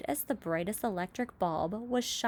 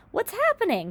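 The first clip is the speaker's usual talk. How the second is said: high, louder startled cry; alarmed cry; 13 semitones higher, 6 dB louder than usual. A narrator's reading. James leaps up as the floor heaves, alarmed cry. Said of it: "cried James".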